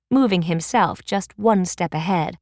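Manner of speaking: plain reading voice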